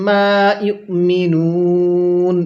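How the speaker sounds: মা ইউমিনুন slow and drawn out; slow tajweed recital; slow Quran recitation. Shown as a demonstration. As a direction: chant মা ইউমিনুন slowly, stretching the vowels. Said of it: "এরকমভাবে শিখব।".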